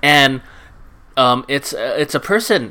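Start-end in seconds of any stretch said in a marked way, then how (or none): none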